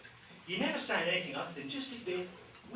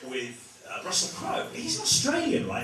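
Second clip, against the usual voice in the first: normal talking voice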